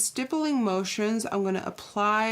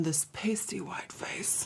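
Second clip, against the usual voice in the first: whispered; 3 semitones lower, 8 dB quieter than usual. Real, not acted. Self-mocking aside about pale skin.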